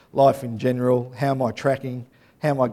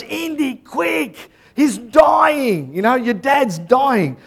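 high, urgent cry of alarm; acted urgent yell; 9 semitones higher, 4 dB louder, much slower than usual. Movie helper calling to Indy that his father is dying, yelling. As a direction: yell the warning in a much higher voice. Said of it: "yells out, goes".

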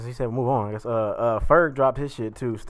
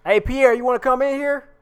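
calling out; 12 semitones higher, 6 dB louder than usual. Real, not acted. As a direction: call loudly to the next room. Calling someone from another room.

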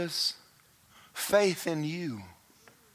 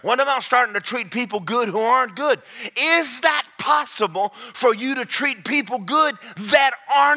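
loud, high, rising sermon shout; full-force preaching; 9 semitones higher, 7 dB louder than usual. Acted. Pastor shouting the sermon at full force. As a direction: preach it to the rafters.

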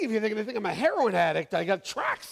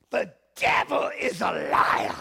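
slow, loud Sierra Leonean preacher's declaration; a Sierra Leonean friend declaring over the sick bed; Sierra Leonean accent; 7 dB louder, slower than usual. Retold or imitated. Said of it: "so that's my best".

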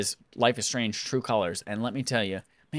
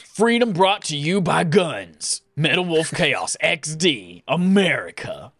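patriotic announcer; 3 semitones higher, 3 dB louder, slower than usual. Pretend.

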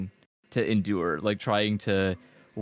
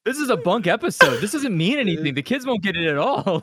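mock complaint; 8 semitones higher, 4 dB louder than usual.

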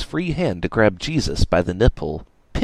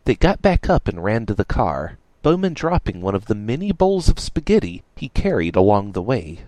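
ordinary delivery, nothing marked

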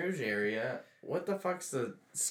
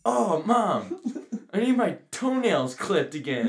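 higher whiny plea to mom; impression of a friend; 6 semitones higher, 4 dB louder, slower than usual. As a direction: plead to mom, a bit whiny. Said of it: "let me say it one more time".

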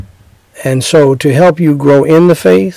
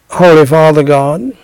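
earnest, measured prayer voice. Acted. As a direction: pray it aloud for the listener to repeat. Leads a sinner's prayer.

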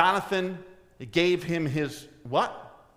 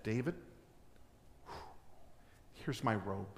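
soft and low, giving it away; quiet, humble gift to David; tender offering; 5 semitones lower, 14 dB quieter than usual. Acted. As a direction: hand your robe to a friend. Jonathan hands over his robe.

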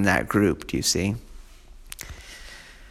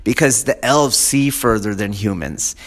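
usual speaking voice

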